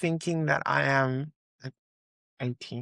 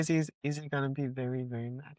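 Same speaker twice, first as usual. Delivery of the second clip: quiet gentle voice; 3 semitones lower, 7 dB quieter, much faster than usual. Retold or imitated. Speaker gently quotes herself to student.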